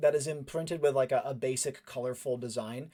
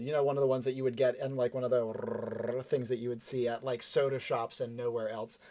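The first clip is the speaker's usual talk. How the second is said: ordinary delivery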